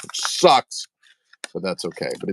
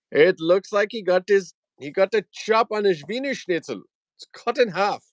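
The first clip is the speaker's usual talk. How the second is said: high-pitched, silly joking voice; high comic squeal; 12 semitones higher than usual. Pretend. Host jokes about toy's anatomy, high comic voice. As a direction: squeak it out in mock amazement.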